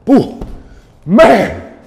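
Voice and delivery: high loud whoop of relief. Real, not acted. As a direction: let out a victory whoop. Cheering after the plank.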